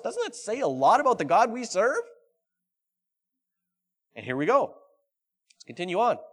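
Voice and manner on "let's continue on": normal talking voice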